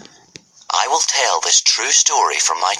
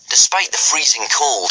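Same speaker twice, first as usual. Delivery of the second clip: plain reading voice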